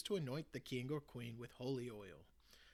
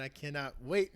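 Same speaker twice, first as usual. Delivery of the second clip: usual speaking voice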